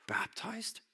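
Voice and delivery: whispered, high and doubting; incredulous whisper. Acted. Preacher mocking the crowd coming only to be baptized, whispered disbelief. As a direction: breathe it like you can't believe it.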